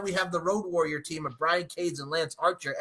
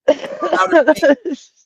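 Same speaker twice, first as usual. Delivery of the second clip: hammed-up outer space; 9 semitones higher, 8 dB louder, much slower than usual. Joking about a wrestler's absence.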